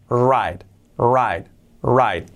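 WRITE with an "ai" vowel; correct pronunciation. Shown as a demonstration. Teacher drills the vowel of write, correct pronunciation. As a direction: say "write" as "rait", silent w. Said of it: "esa I es una I".

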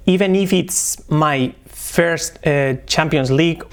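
very slow "Even if it's my first", every T clear; very slow demo. Shown as a demonstration. Teacher demos the T sounds very slowly. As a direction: drag it out, pronounce each T. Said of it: "vamos a hacer la primera T".

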